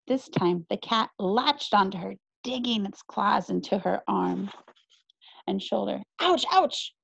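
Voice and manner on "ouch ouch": high sharp ouch of pain; pained yelp. Acted. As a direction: yelp as claws dig in. Cat's claws dig in.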